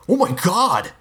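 high-pitched startled shout. A narrator's reading. Rob spots someone watching by the river, startled shout. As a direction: cry out in shock. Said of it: "I heard Rob shout".

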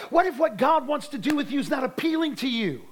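full-force revival preaching; fiery preaching. Acted. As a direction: preach it hard to the congregation.

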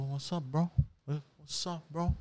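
soft, offhand what's up, twice; quiet greeting. Shown as a demonstration. Greeting the driver pulling out, softly casual. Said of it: "I go to him like this".

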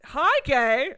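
loud, high mock-cheery greeting; Megan Statler. Podcaster quoting a comedian's greeting, loud mock cheer. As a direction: sing out an over-the-top hello. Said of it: "God, I love Megan Statler".

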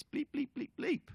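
high-pitched imitation of censor beeps; censor bleep. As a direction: chirp it like a bleeped-out curse.

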